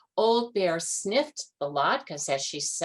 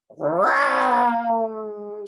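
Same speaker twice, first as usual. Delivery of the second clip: loud growled row; bear row; 4 semitones higher, 7 dB louder than usual. A narrator's reading. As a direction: grumble like a bear. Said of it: "said the bear".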